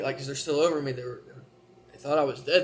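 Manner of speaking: ordinary talk, nothing marked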